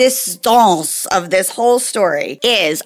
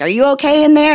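raised call toward the next room; 6 semitones higher, 4 dB louder than usual. Retold or imitated. Nanny calls to the boy in the bath, raised call. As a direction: call through the door, checking on him. Calling to child in bathtub.

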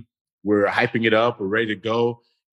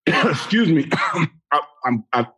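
choking on a cough; coughing, then croaking out words; coughing fit; 6 semitones higher than usual. Real, not acted. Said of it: "feel like I'm choking right now".

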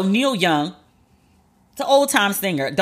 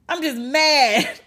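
slow admission; 5 semitones higher, much slower than usual. Real, not acted. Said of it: "Can you tell I'm still mad?".

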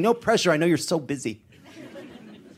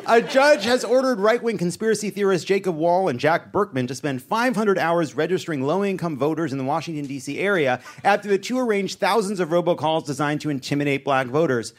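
ordinary delivery

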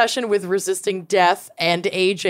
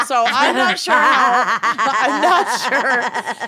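half-laughing as she reads; laughing through words; 2 semitones higher, 3 dB louder than usual. Real, not acted.

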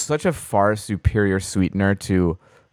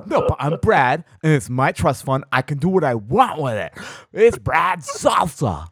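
mock Brad; 5 semitones higher than usual. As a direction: play a smug trust-fund bro. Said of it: "Brad, we highly recommend".

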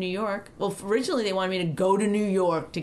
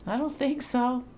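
voice cracking; 4 semitones higher than usual. Real, not acted. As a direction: voice cracks mid-phrase. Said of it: "why would your voice crack like that".

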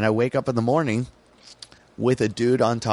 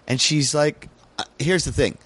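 ordinary talk, nothing marked

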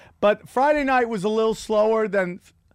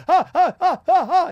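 nervous laugh; 8 semitones higher, 7 dB louder than usual. Retold or imitated. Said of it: "You're like".

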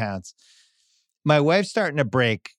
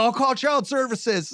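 mock kid threat; 10 semitones higher, slower than usual. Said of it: "He's like".

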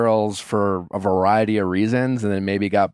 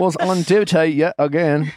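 put-on amateur British accent, pitched up; put-on British accent, higher voice; 8 semitones higher, 4 dB louder than usual. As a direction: try reading it like a Brit. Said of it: "can you do a British accent?".

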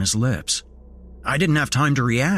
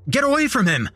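high, urgent yell; faster than usual. A narrator's reading. Narrator charging at the attacking wolf, yelling. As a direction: yell to drive an animal off. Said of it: "I yelled".

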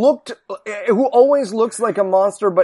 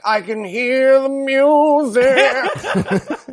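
slow, higher, spaced-out mumble; dazed Carson voice; 5 semitones higher, much slower than usual. Retold or imitated. Comedian mocking a doped-up Carson, slow dreamy voice. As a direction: float the words out, drugged and dreamy.

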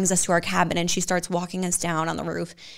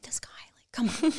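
whispered eye-roll; 6 semitones higher, 5 dB quieter than usual. Retold or imitated. Mock exasperation at fiancé.

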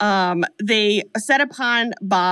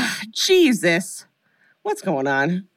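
slow, offhand sigh of a line; casual and tossed off; 2 semitones lower, much slower than usual. Retold or imitated. Mimicking a horror actor's offhand read of a line. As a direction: toss it off like it barely matters. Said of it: "casual and tossed off".

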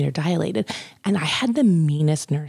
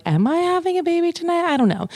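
panicked high voice; 12 semitones higher than usual. Retold or imitated. Panicked self-quote, high voice.

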